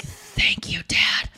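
breathy aside whisper; whispered thanks. Retold or imitated. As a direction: whisper it like a private joke. Whispered mock reply to the fan.

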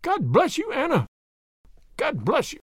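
high, fervent blessing; grateful old professor. Acted. Old husband thanks his wife.

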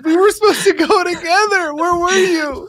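high, loud frantic yell; panicked shouting. Acted. Panicked accusation.

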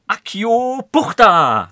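drawn-out, loud incantation shout, pitched high; mock spell-casting. Podcast host pretends to summon his guest by wand, mock spell-casting. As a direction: bellow it like a pretend wizard, stretching the words.

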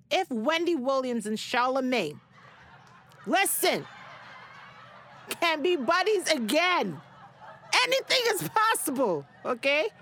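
laughing, rising high and loud with delight; excited. Real, not acted. Host gushing to listeners about Wendy Williams and Charlamagne reconciling, excited and laughing. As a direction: bubble over, laughing as you go. Celebrity feud patched up, gushing.

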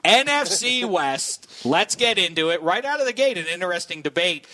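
hyped intro. Acted. Host kicks off segment.

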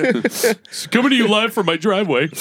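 high, put-on newscast delivery; mock live reporter. Joking as the anchor reporting own accident, high reporter voice. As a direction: announce it like a live TV hit.

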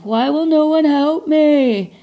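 high, slow, self-pitying whine; whiny victim voice. Retold or imitated. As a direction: plead like a sorry victim. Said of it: "I'm like".

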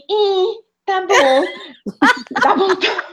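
high, slow, drawn-out unimpressed voice; a gringo shrugging at the sweet. Retold or imitated. Gringo tasting brigadeiro.

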